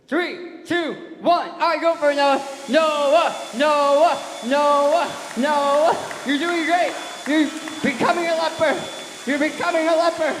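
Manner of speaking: high, shouted countdown and encouragement; excited stage-host yelling; loud cheering on. Real, not acted. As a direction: urge the volunteer on at full volume. Cheering on volunteer in stage game.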